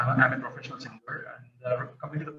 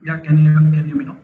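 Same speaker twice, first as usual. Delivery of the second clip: louder, raised for the mic; speaking up; 5 semitones higher, 13 dB louder than usual. Real, not acted. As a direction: push the voice out to be heard. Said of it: "Your voice is very low".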